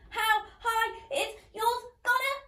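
ordinary delivery, nothing marked

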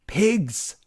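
sharp high fierce cry of Pigs; fierce shout. A narrator's reading. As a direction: spit the word out like a furious witch. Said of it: "shouted Circe fiercely".

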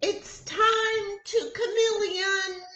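high, loud teacher yell; high teacher shout. A narrator's reading. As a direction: call the class in across the playground. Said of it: "she yells".

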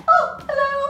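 high singsong peekaboo hello, laughing; playful surprise. Real, not acted. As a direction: pop out with a delighted hello. Peekaboo reveal to baby.

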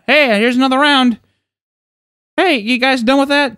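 high, chirpy mom imitation; sing-song mock hostess voice; friend's mom offering snacks. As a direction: call it out high to the kids.

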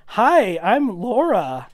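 high, drawn-out acted hello; interested introduction. Laura introducing herself to George, openly interested.

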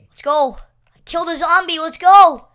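loud cheering "Let's go"; celebrating. Real, not acted. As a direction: cheer the kill. Zombie kill celebration.

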